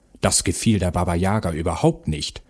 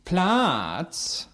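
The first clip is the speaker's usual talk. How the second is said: bossy animal character; 10 semitones higher, 4 dB quieter than usual. Acted. Animal bosses the dog about, haughty. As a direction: order it high and drawn out.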